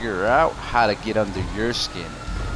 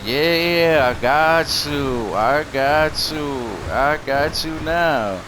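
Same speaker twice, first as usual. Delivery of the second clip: slow taunt; 3 semitones higher, much slower than usual. Retold or imitated. Taunting rival, slowly.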